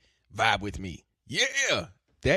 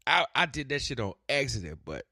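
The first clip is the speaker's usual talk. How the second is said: usual speaking voice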